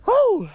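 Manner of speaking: a big celebratory yell, very high; high loud whoop. Real, not acted. Angler celebrating redfish number two, high loud whoop. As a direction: let out a victory whoop.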